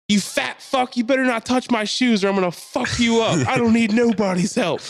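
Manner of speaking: high, loud mock tirade; screaming woman. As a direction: rant like a woman in meltdown. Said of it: "this woman's screaming at this guy".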